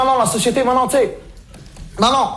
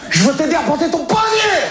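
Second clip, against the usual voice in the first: shouted offer; 8 semitones higher than usual. Acted. He shouts back at the screaming old lady, offering help. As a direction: shout it over her screaming.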